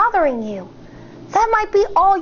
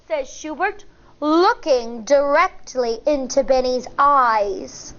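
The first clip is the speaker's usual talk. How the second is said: ordinary delivery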